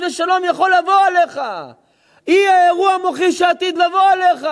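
high, loud fervent sermon; thundering preacher; full-force preaching. Acted. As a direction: preach it hard to the hall.

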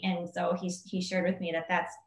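ordinary delivery

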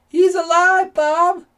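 loud, very high-pitched shout; joyful high yell to a friend; excited cry. A narrator's reading. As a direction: cry it out at full volume, way up high. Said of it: "cried Mark".

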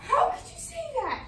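high-pitched, wounded disbelief; appalled cry, without shouting. Acted. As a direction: voice leaps up in protest. Shocked at remark about father.

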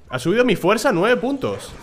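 high, loud and slow; excited announcement. Real, not acted. Gamer thrilled that his strength jumped, slow, high and loud. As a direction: stretch it out, thrilled. Stat jump surprise.

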